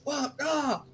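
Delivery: startled cry. Acted. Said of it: "they go like".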